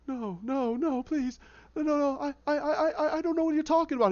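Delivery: high, stammering protest; panicked pleading. Retold or imitated. Captive pleading ignorance to Penguin, panicked. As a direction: trip over the words in mounting panic.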